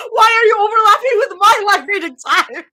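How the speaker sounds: high, loud, hammed-up yell of complaint; mock outraged shout. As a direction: shriek it in mock outrage at the overlap. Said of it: "and I was like".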